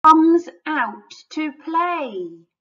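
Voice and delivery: slow, high, loud reveal; dramatic narration. A narrator's reading. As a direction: draw out the big moment. Climax of the story.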